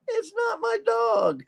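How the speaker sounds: very high, slow squeaky punchline; hard-of-hearing man. Retold or imitated. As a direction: imitate the hotel man, drawn out and squeaky. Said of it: "He can't hear".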